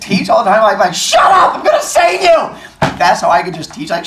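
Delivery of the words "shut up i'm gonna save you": high, loud hammed-up rescuer's yell. Teacher playing the rescuer to a kid, mock yell. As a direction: yell it bossily, half laughing. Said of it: "just yell at something".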